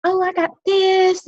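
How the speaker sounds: high, slow, bubbly aside; sweet chatty checkout voice; chirpy fumble. Shown as a demonstration. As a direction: act flustered on purpose to buy time. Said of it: "Slow it down".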